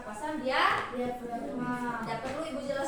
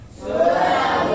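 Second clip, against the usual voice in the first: loud group answer; class chorus; 9 semitones lower, 13 dB louder than usual. Real, not acted. Students answer the teacher in unison, loud chorus. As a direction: everyone calls back together.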